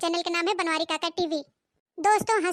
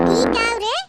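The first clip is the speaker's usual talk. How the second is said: shocked outburst; 6 dB louder than usual. Acted. Mother-in-law reacts to the smell.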